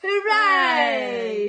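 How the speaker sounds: high, loud hooray; cheer. Acted. Animals cheering the divas, a loud high hooray. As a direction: cheer it like the whole crowd. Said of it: "say all the animals".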